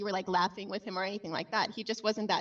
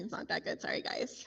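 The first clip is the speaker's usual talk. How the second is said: breathless whispered apology; 8 dB quieter than usual. Real, not acted. Whispering an embarrassed sorry to the group. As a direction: whisper it sheepishly.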